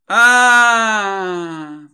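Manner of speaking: loud moaning groan; drawn-out groan. Acted. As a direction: groan as if just remembering. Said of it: "простонала девушка".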